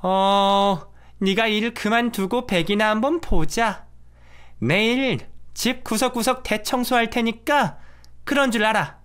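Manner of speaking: bossy mother-in-law. Acted. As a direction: lay down the law like a domineering mother-in-law. Domineering mother-in-law gives orders. (높은 톤으로 명령하는 시어머니)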